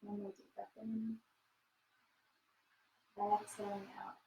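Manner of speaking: soft, calm breathing cue; soft spa whisper. Acted. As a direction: ease them into stillness. Guides breathing in cool-down.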